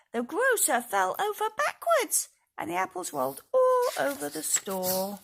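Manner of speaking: high, loud excited telling; excited narration. A narrator's reading. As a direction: burst out with the mishap. Dramatic story climax.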